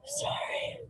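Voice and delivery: high, tearful whispered sorry; sobbing whisper. Real, not acted. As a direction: whisper it through tears. Crying apology whisper.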